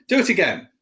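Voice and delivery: high, loud demanding order; bossy Dudley. A narrator's reading. Dudley ordering his father to tap again. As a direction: bark it like a spoilt boy. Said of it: "Dudley ordered".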